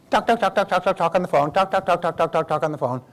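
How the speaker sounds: high, rattled-off string of identical words; mock nonstop yakking; mimicked phone chatter.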